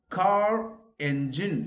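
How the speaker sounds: car engine with the R sounded into engine; linking R. Shown as a demonstration. Teacher drilling students: car engine, R linked and slow. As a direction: link the R of car onto engine, slowly. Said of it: "So you say car engine".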